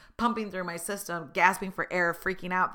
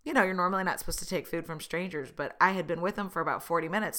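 ordinary talk, nothing marked

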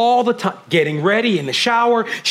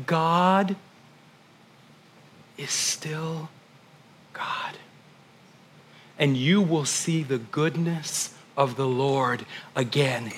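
barely voiced, intimate hush; intimate whisper; 2 semitones lower, 6 dB quieter, slower than usual. Pastor whispering hope to his grieving family. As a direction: lean in and whisper the promise. Hushed declaration.

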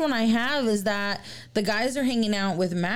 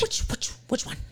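ghostly whisper; 5 dB quieter than usual. Retold or imitated. Imitating the EVP voice.

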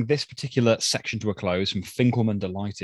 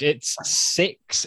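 ordinary talk, nothing marked

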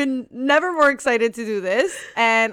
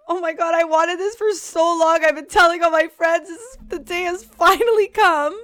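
high, louder whiny lament; mock despair; 7 semitones higher, 4 dB louder than usual. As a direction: wail like the world ended. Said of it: "I'm like".

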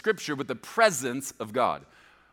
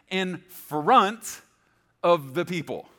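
"in front" stretched out slowly and firmly; emphatic scripture reading; 5 semitones higher, 5 dB louder, much slower than usual. A narrator's reading. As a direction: read "in front of the people" slowly, weighting each phrase. Key scripture phrase read with emphasis.

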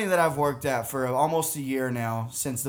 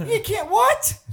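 high-pitched, rising disbelief; retold baffled squawk; incredulous; 6 dB louder, slower than usual. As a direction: end on a loud, squeaked question. Retelling disbelief at the foreman's news.

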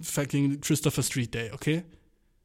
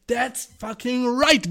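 high, slow, triumphant drawl; savouring a fifty-cent victory, high and slow; empowered swagger; 8 semitones higher, 5 dB louder, much slower than usual. Retold or imitated. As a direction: crow it like a proud champion. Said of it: "So hab ich mich gefühlt".

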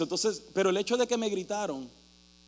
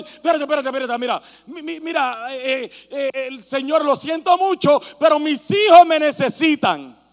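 pleading Samuel; 3 semitones higher, 6 dB louder than usual. Retold or imitated. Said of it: "Dijo Samuel".

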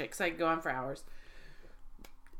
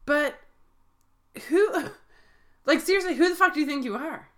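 outraged; 7 semitones higher, 5 dB louder than usual. Real, not acted. Outraged rant about the husband.

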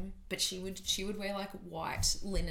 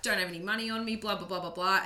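a louder ocker Australian voice; broad Aussie accent; 4 dB louder than usual. Retold or imitated. Tourist pleading to inspector, broad ocker Australian accent. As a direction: keep playing the clueless Aussie. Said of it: "most ochre accent you could do".

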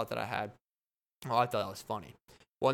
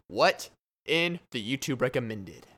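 segment title; 3 dB louder than usual. Acted.

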